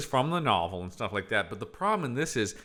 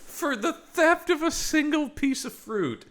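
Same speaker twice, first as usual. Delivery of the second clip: mock outrage; slower than usual.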